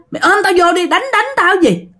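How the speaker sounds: high, exasperated telling-off; acted scolding. Mother telling the passenger to hold on, scolding. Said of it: "Mẹ la cái mẹ nói".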